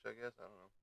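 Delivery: ordinary talk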